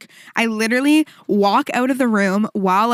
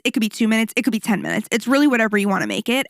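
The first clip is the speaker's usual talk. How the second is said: plain reading voice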